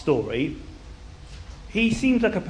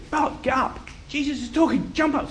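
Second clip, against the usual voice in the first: urging; 10 semitones higher than usual. Acted. Crowd urgently summoning Bart.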